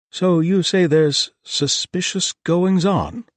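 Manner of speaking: high, loud and slow questioning; eager, leaning in; curious host. A narrator's reading. The host asking the visitors about trouble, high and eager. Thomas voiced, leaning forward.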